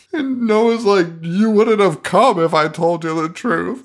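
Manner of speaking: high-pitched incredulous imitation breaking into laughter; hammed-up high retelling; laughing mock quote. Mocking movie line while laughing.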